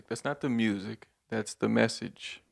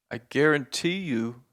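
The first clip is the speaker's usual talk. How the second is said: ordinary talk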